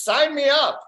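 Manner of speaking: high, keen burst of enthusiasm; mock-eager volunteer; eager acceptance. Acted eagerness for cannabis job.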